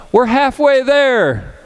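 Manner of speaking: high, drawn-out announcement; skydiving instructor. Acted. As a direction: stretch it out high, like an instructor giving an update. Instructor calls out progress to nervous jumper.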